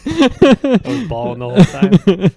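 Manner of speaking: slow, loud laughing confession. Real, not acted. Admitting she cried at the dance, slow laughing and loud. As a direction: laugh through the admission.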